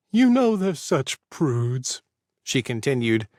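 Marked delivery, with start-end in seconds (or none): 0.1-2.0 put-on high, drawn-out old lady voice; slow gossipy old lady. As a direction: savor the word like a gossip.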